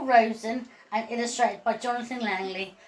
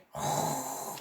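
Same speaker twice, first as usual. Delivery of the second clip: snore; 9 semitones lower, 11 dB quieter than usual. Acted. Dog snoring in the story.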